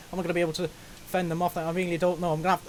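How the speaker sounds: rapid-fire commentary; fast auctioneer talk. Real, not acted. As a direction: rattle it off like an auctioneer. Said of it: "I'm speaking like a bloody auctioneer here".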